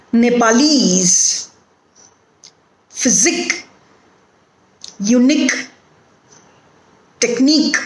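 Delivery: word list with the final syllable stressed, "Brigade" as bri-GADE; stress on suffix. Shown as a demonstration. Teacher giving examples of suffix stress, last syllable stressed. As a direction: land on the ending of each word, "engineer" as en-gi-NEER. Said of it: "the suffix itself is stressed".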